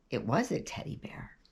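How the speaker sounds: whispered reveal, breath only; hushed whisper. A narrator's reading. Narrator whispering as he recognises the teddy bear. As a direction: drop to a whisper for the surprise. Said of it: "whispered reveal".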